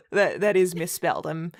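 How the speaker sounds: ordinary talk, nothing marked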